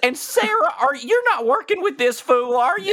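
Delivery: high, desperate small-town sheriff; frantic sheriff. Acted. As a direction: plead for rescue, high and pleading. Rescued sheriff voiced by GM.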